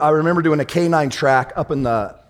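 ordinary delivery

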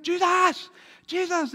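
high, loud frantic calls of a name; frantic calling. Retold or imitated. Disciples in the sinking boat calling to Jesus, high frantic cry. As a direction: call out like you're going under.

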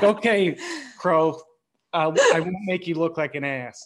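amused promise, laughing as you speak; laughing reply. Real, not acted. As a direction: answer while cracking up. Laughing through his retold reply.

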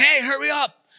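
mildly urgent retold call for backup; urgent plea. Brother calls out to his estranged sibling for help, urgent. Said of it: "he kinda needed me".

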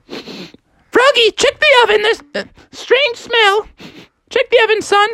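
very high falsetto mom; high-pitched motherly warning; alerted mother. Acted. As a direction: call to Froggy in a high mom voice. Mother calls about strange smell.

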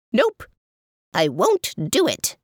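high squeak on nope; lightly voiced stubborn chair; squeaky chair voice.

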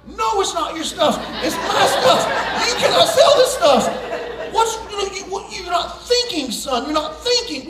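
high, loud outraged yell; hammed-up screaming dad; retold shouting. Father yelling at son that the stuff is his.

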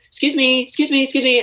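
politely calling customers down the street; bright chirpy call for attention; calling after them. Retold or imitated. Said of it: "I'd be like".

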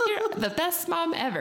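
put-on high-pitched adoring kid voice, laughing; mock childish adoration; young children. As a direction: gush like little kids praising mom. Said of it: "When I'm young, they'll be like".

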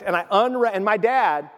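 usual speaking voice

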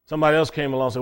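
usual speaking voice

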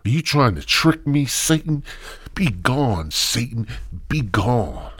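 high, loud be gone shout; mock exorcism. Retold shout casting Satan out of the podcast. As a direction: cast the devil out.

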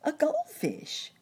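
high, slow cricket repeat; drawn-out chirpy answer; chirping cricket voice. Acted.

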